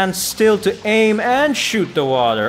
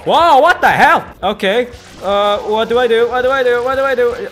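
panicked surprise; 8 semitones higher, 6 dB louder than usual. Real, not acted. Panicked surprise while playing.

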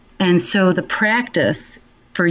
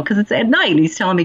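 ordinary talk, nothing marked

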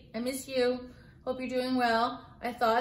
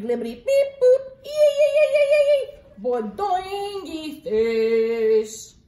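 squeaky, shouted gibberish, much higher and louder; silly nonsense sounds; 6 semitones higher, 9 dB louder, slower than usual. Acted. Teacher making the book's silly sounds for her class, loud and squeaky. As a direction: go wild with goofy noises. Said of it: "I have to make silly sounds".

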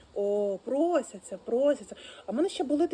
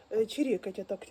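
ordinary talk

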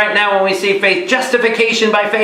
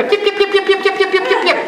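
high yapping yip yip yip; yapping chatter; 14 semitones higher than usual. Retold or imitated. A priest mocking mourners who chatter at a wake, high yapping. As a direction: yap like little dogs to mock the chatter.

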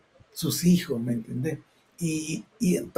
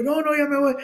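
nervous; 9 semitones higher than usual. Retold or imitated.

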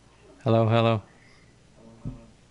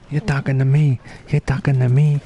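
louder and higher, a hammed-up movie tough-guy challenge; mock tough guy; 4 semitones higher, 6 dB louder than usual. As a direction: throw it at the chat like a wannabe gangster. Movie tough-guy quote to chat.

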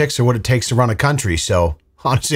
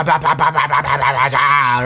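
rapid-fire "pa" gunshot noises; imitated gunfire; 3 semitones higher than usual. Acting out the brother's shooting spree, mouthed gunfire. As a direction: spit out mock machine-gun fire by mouth. Mimics gunfire in mocked threat.

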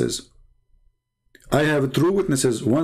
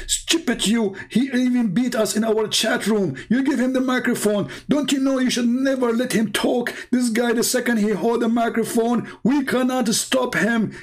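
high, scolding rebuke of a fellow member; 10 semitones higher than usual. Retold or imitated. Member scolding the one who gave the microphone, high. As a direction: tell him off, pitched way up. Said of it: "he said to him".

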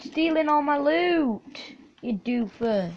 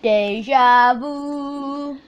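very slow drawn out; 3 dB louder, much slower than usual. Acted. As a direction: stretch each syllable very slowly. Echoing the meme very slowly.

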